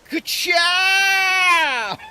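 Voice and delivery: high, loud, drawn-out yell; shouted catchphrase. Acted. Player cheering on a move in the game, loud catchphrase yell. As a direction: belt it out high and hold it.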